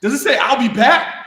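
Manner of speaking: high, loud mock-amazed question; incredulous sergeant. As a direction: lean in and ask it in disbelief. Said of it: "he's all like".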